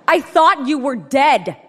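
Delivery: shocked scream, loud and high. Retold or imitated. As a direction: yell it in disbelief. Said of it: "he screamed".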